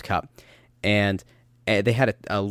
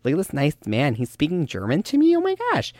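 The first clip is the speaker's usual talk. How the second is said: higher, amazed tourist gushing over a German speaker; 5 semitones higher than usual. Retold or imitated. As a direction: play the dazzled Epcot visitor, squealing at the end. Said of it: "they're like".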